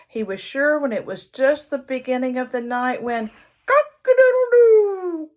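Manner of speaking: usual speaking voice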